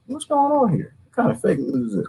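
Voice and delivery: ordinary talk, nothing marked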